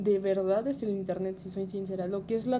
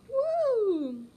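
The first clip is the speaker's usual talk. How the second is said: mock cheer; 13 semitones higher, 4 dB louder than usual. Mock celebration of divorce.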